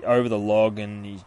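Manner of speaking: ordinary talk, nothing marked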